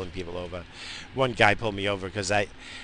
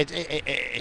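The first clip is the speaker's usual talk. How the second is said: ordinary talk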